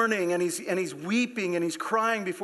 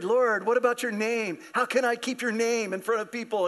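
worried; 6 semitones higher than usual. Acted. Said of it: "Joshua's worried".

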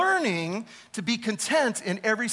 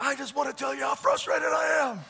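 higher, pushed complaint voice; retold frustrated complaint; 6 semitones higher than usual. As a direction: replay telling off the store. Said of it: "I was like".

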